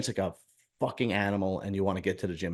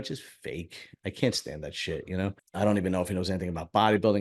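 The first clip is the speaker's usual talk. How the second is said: ordinary delivery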